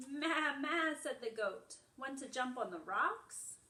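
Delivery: animated picture-book read-aloud; reading to children. Acted. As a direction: read it brightly to little ones. Reads picture book to class.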